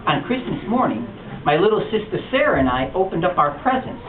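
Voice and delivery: ordinary delivery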